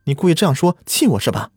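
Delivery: high, fast, accusing outburst; angry shouting. A narrator's reading. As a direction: hurl the accusations at him. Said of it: "小慧叫道".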